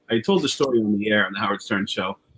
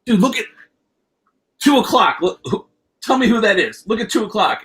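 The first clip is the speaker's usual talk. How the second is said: excited urging; 10 semitones higher than usual. Retold or imitated. Telling buddies to look at two o'clock, excited. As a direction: point him out, thrilled. Urgently pointing out Eddie arriving.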